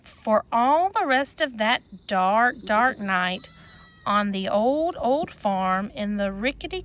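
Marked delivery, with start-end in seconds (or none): none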